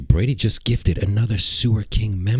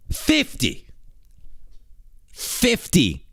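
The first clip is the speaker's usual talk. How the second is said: can't believe the number; stunned disbelief; 7 semitones higher, 4 dB quieter, much slower than usual. Real, not acted. Huge gift of fifty memberships.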